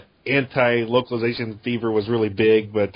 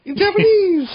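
high squeal; 5 dB louder than usual. Retold or imitated. Repeating the line as a joke.

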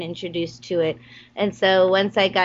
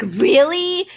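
high, loud, eager question; excited really; 7 semitones higher, 5 dB louder than usual. Retold or imitated. Actor hearing she's cast, eager high question. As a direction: jump at the offer. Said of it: "And I was like".

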